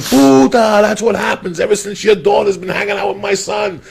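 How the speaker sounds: loud, high, furious rant; angry neighbour mother. Retold or imitated. Neighbour mother yelling through open windows, loud and furious. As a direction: shout the accusation over the fence. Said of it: "and she's like".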